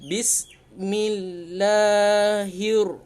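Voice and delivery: "Bismillahir" drawn out very slowly, the l merging to r; slow recitation. Shown as a demonstration. Teacher shows alif lam dropped, slow recitation.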